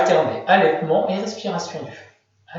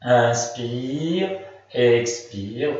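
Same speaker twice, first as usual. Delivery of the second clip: guided breathing; 6 semitones lower, much slower than usual. Shown as a demonstration. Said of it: "guides breath in a relaxation".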